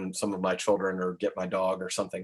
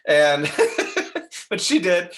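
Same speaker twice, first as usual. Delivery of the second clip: higher, louder, talking through laughter; laughing; 7 semitones higher, 7 dB louder, slower than usual. Real, not acted. Teacher confirming how the young lady really talked, laughing. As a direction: insist on it while laughing.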